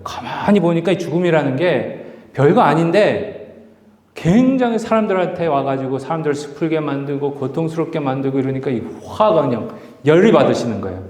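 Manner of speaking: usual speaking voice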